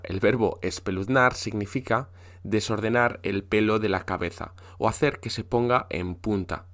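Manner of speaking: usual speaking voice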